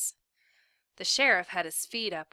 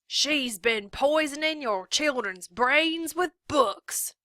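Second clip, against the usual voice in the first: loud, higher, slow rabble-rouser; declaring; 7 semitones higher, 7 dB louder, slower than usual. A narrator's reading. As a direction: proclaim it to the mob. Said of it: "Trout declared".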